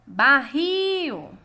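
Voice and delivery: "Barril" called loud, RIL drawn out; calling the word. Shown as a demonstration. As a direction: push and hold the RIL of "Barril". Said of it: "exercício de chamar as palavras". (chamando "Barril", com o RIL forte e alongado)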